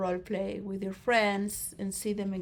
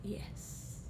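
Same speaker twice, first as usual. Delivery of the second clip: a breathy whisper; whispered yes; 12 dB quieter than usual. Acted. Philosopher admitting it to the crowd in a whisper. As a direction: let the word barely escape. Quiet admission after the outburst.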